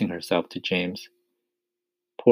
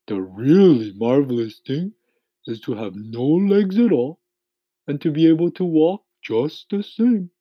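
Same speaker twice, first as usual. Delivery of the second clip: higher-pitched earthworm voice; opinionated earthworm; 8 semitones higher, 5 dB louder than usual. A narrator's reading. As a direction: hold forth as a storybook earthworm.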